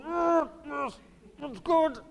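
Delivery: high, muffled, held-in assent; mouth not moving. Retold or imitated. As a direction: keep the lips shut and squeak agreement. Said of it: "my mouth's not moving".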